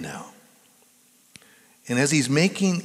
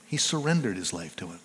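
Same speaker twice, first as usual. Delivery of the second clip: softer, faster repeat of the line; quieter quick echo; 6 semitones lower, 7 dB quieter, faster than usual. Acted. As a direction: let it fall away quickly, hushed.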